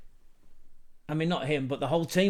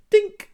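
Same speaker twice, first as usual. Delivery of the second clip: sharp high "dink!" much louder, like a ball chipped; mock dink sound; 12 dB louder than usual. Mimicking the chipped goal for viewers, high loud dink. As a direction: pop out a squeaky "dink!".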